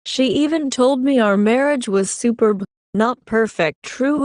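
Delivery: usual speaking voice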